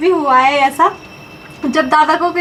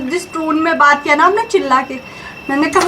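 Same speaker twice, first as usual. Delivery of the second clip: ordinary delivery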